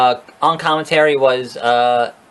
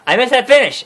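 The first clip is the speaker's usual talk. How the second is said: higher, excited second take; excited repeat; 7 semitones higher, faster than usual. Shown as a demonstration. Vlogger repeating his line to viewers, higher and excited. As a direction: say it again, now thrilled.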